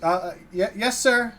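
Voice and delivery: a startled soldier tripping over his reply; stammering guard. Acted. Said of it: "and he goes".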